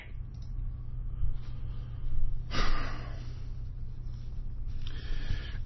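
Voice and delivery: very long, soft exhale; exasperated sigh. Real, not acted. Broadcaster winding down after yelling, long weary sigh. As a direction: let the anger drain out slowly.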